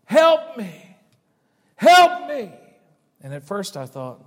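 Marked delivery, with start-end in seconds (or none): 0.1-2.5 high, loud cries of help from a woman; woman screaming for help. Retold or imitated. Preacher voicing a woman's screams in the night. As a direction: cry out like a terrified woman. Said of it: "the screams of a woman screaming for help".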